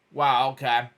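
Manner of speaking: plain reading voice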